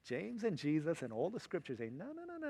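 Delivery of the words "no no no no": high, soft string of no's; playful high-pitched refusal; dismissive no-no. Acted. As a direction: wave it away in a light, high voice. Voicing scripture rejecting the excuse.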